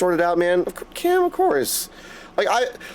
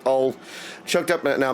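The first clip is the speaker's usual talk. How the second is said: quiet, holding back tears; fighting to keep the voice steady; choked up; 6 semitones lower, 4 dB quieter than usual. Real, not acted. Streamer to friend Cam, getting emotional and quietly choked up. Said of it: "trying not to get all choked up".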